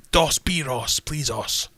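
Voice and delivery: mock-aggressive tourist Spanish, loud and high; loud, aggressive Englishman. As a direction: bellow it like an Englishman abroad. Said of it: "say it louder and more aggressively".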